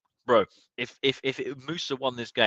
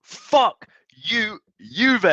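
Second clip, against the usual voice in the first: louder, higher jeering at the rival; gloating taunt; 11 semitones higher, 5 dB louder, much slower than usual. Real, not acted. Fan taunting the rival club, gloating shout. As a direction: rub it in their faces.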